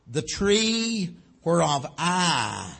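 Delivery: "tree" and "I" drawn out long with pauses after; slow, pointed weight on "tree" and "I"; stress on tree, I. Shown as a demonstration. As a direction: lean hard and long on "tree" and "I". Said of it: "he's putting emphasis on Adam, on this, this emphasis".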